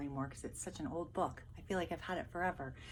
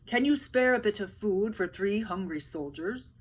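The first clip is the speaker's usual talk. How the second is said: louder, polite request; pleading soldiers; 2 semitones higher, 9 dB louder than usual. Acted. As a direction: ask humbly for food. Soldiers begging food.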